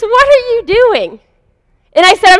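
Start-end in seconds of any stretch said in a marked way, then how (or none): 0.0-1.2 much higher and slow, chuckling as he asks; an amused, teasing ask, pitched up; amused laughing question. Retold or imitated. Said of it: "he laughs, he says".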